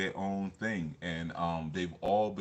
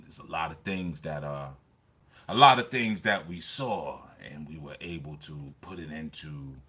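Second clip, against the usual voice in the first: Baba Randy, smooth; 4 semitones lower than usual. Retold or imitated. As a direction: ease into it calm and mellow like Baba Randy. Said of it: "he's just smooth".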